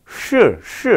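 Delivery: "시" with the tongue curled back; retroflex demonstration. Shown as a demonstration. Lecturer shows students the Chinese retroflex, "시" said as a retroflex. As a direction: curl the tongue tip back on each "시". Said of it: "시라고 하는 발음이 권설음이에요".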